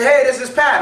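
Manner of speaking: caller's greeting. Retold or imitated. Caller introducing himself on the phone, lightly voiced. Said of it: "You're gonna say".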